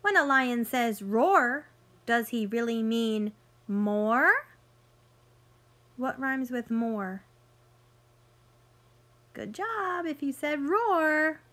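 bright storybook read-aloud for little ones; picture book voice for kids; reading to children. Acted. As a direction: read it like story time on the rug.

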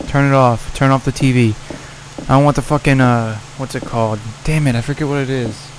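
ordinary talk

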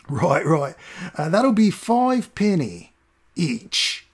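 high, slow cockney, haggling; cockney doorman. Acted. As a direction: drag out the price like a sly cockney doorman. Doorman names the price.

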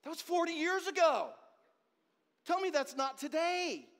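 loud, high-pitched preacher's outburst; emphatic exclamation. Real, not acted. Preacher challenging congregation about a decades-old quote, loud exclamation. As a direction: raise your voice and drive the point home.

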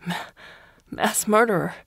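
blubbering. A narrator's reading. Prisoner confessing under the blade, blubbering. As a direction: choke the words out through tears. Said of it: "he blubbered".

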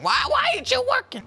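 high mock complaint; exasperated whine. Real, not acted. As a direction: squeak it at the broken chat. Voice chat broken.

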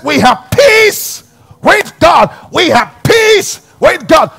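high, loud, slow proclamation; full-force preaching. Real, not acted. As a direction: hammer home the message of peace.

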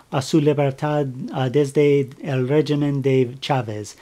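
ordinary delivery

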